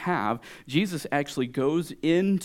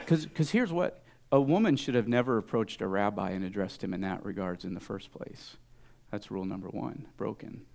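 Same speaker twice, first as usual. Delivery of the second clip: normal talking voice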